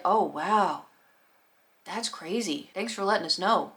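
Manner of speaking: quiet, uninterested acknowledgement; mock indifferent. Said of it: "You really don't care".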